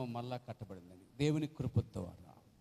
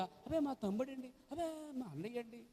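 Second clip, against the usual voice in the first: high, softer mimicking voice; mock excuse; 10 semitones higher, 3 dB quieter than usual. Preacher mimicking a believer excusing a relative, high and coy.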